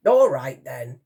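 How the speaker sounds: grudging, loud start then dropping; huffed. A narrator's reading. Admiral relenting to the girl, huffed. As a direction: give in with a huff. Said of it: "he huffed".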